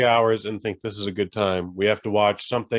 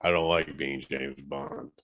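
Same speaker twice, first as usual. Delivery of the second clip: mock Daniel Craig; 9 semitones lower, 7 dB quieter than usual. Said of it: "he was like".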